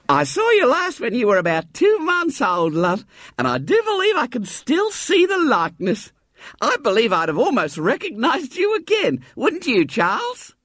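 motherly lady. Acted. Mrs Maddox greeting the boy at the door, high and motherly.

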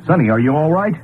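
anxious call into the dark. Acted. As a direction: call her name, worried. Calling for missing girl at night.

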